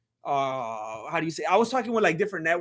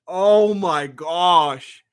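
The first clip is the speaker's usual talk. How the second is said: very slow, louder groan of words; drawn-out dismay; 4 semitones lower, 4 dB louder, much slower than usual. Real, not acted. Streamer appalled by the decision, very slow drawn out. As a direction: stretch it out in disbelief.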